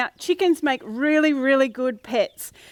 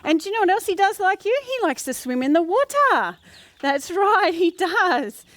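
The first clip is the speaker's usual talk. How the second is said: put-on reading to a toddler; 4 semitones higher than usual. Talks to a duckling for kids.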